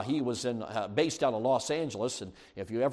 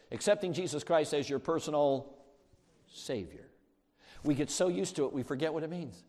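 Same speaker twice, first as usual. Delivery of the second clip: ordinary delivery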